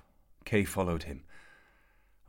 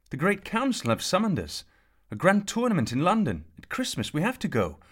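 much higher, louder insistent pleading; pleading excitement; 13 semitones higher, 8 dB louder than usual. A narrator's reading. Kay begging his father to go to London, high and urgent.

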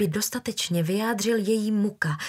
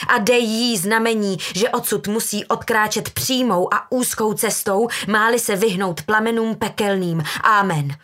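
loud, fervent prayer; mother's stern invocation; declaiming; 2 semitones higher, 5 dB louder than usual. A narrator's reading. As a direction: pronounce it like a hellfire sermon, hard Amen. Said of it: "deklamovala matka".

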